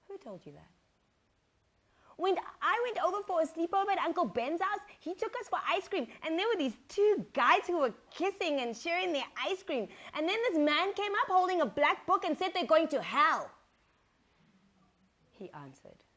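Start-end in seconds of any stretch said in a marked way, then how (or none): 2.2-13.5 put-on high, eager boy telling; child voice. Son explaining to his mother what he saw, high and eager. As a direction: narrate it like an excited kid.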